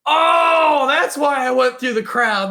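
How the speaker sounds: long, loud, high "oh" of remembering; sudden realization. Retold or imitated. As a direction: re-enact it dawning on you, loudly.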